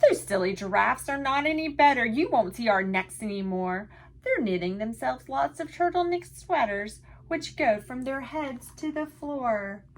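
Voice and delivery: lively picture-book read-aloud in rhyme; sing-song storytime voice for little listeners; reading to children. A narrator's reading. Teacher reading a rhyming book to children, lively.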